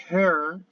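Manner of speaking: hair said with an open E, "her" sound opened up; teacher's model of hair with open E; open-vowel hair. Shown as a demonstration. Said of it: "hair es una E abierta".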